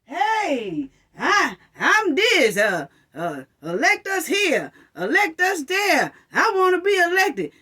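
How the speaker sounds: mock a begging candidate; mocking politicians. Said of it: "you know what I'ma say".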